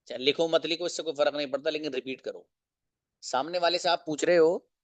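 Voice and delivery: normal talking voice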